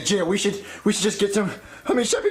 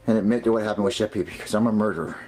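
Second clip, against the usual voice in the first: dejected resignation; 9 semitones lower than usual. Acted. Resigned to confessing to murder.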